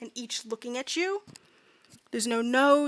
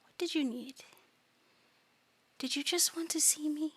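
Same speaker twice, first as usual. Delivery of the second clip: soft, whispery eldritch coo; hushed, tender monster voice; eerie doting creature; 4 semitones higher, 8 dB quieter than usual. Acted. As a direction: coo softly and sweetly from the dark.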